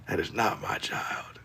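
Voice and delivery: unvoiced, slow whispered denial; hushed denial to chat. Real, not acted. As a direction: whisper it like a secret.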